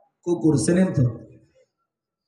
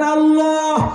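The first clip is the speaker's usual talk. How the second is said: a higher, heartfelt call of Allah; loving call; 8 semitones higher, 4 dB louder, much slower than usual. Shown as a demonstration. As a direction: call out with affection. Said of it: "সবাই একটু মহাব্বতে ডাক দেন". (মহব্বতের ডাক)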